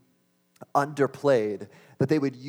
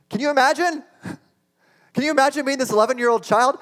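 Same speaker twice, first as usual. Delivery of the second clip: much higher and louder, rushing the second question; preacher pleading, high and loud; excited appeal; 12 semitones higher, 7 dB louder, faster than usual. Real, not acted. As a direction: press the crowd to feel it, quickly.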